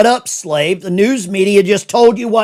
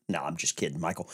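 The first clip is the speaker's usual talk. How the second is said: quiet aside; 12 semitones lower, 15 dB quieter, faster than usual. Acted. Sudden drop after a mock jab.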